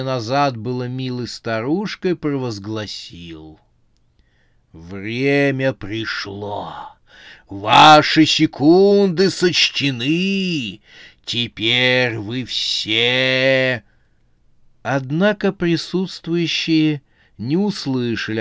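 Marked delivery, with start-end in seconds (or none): 4.7-13.8 thunderous, very slow doom voice; vast deep cosmic voice. A narrator's reading. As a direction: proclaim it like a cosmic being. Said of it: "Громоподобный голос существа".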